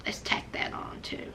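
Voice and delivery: soft snide whisper; whispered aside. Real, not acted. Slipping a dig to viewers, whispered.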